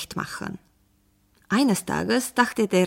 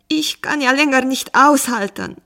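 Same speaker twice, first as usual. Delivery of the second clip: higher, louder desperate complaint; wailing; 5 semitones higher, 6 dB louder than usual. A narrator's reading. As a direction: lament at the end of patience. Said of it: "jammerte der Bauer".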